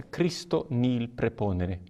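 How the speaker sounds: "Christo nihil preponere" slow, with "nihil" said "nil"; correct Roman Latin. Shown as a demonstration. Priest repeating the correct Roman Latin for learners, slow and careful. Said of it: "Essa é a pronúncia correta".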